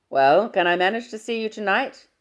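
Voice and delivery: booming fatherly hello down the line. Acted.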